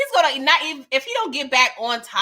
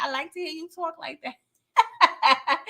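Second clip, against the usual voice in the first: slow, laughing through the words; drawn-out laughing repeat; 6 semitones higher than usual. Real, not acted. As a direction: savor it, laughing.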